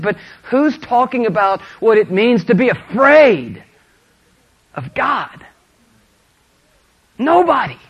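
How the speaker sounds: high, loud sermon climax; impassioned preaching. Real, not acted. Preacher challenges congregation on fearing God, impassioned and building.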